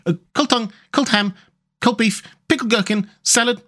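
high-pitched run-through of items; Rat listing food. Acted. Rat listing the picnic for Mole, high voice. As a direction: reel off the menu in a character voice.

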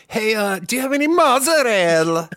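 high, loud, hammed-up Italian accent; over-the-top Italian accent; huge put-on accent. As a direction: belt out the food word in a mock Italian accent. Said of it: "put this huge accent on the one word".

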